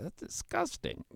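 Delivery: put-on high, slow disgusted girl; little girl. Princess refuses to eat beside the frog, high and disgusted. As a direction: squeal it out in disgust.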